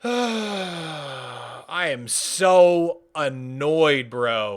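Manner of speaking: very slow, stretched-out groan of words; slow exhausted complaint; drawn-out annoyance. Real, not acted. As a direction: drag every word out in weary frustration.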